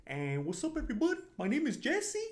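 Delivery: early character intro in a very high voice; strained-high, exaggerated character greeting; Jesse. Shown as a demonstration. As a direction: greet the audience in an overly high cartoon voice. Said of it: "I used to talk like this".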